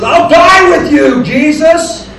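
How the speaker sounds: high, loud cry; impassioned vow. Retold or imitated. Preacher as Peter pledging himself to Jesus, impassioned shout. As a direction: shout it out with rash devotion.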